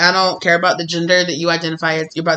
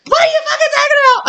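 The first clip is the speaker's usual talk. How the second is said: much higher laughing shout at the stubborn one; mock outraged yell; 5 dB louder, faster than usual. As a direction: blow up at her, half laughing. Spirit scolds the stubborn speaker.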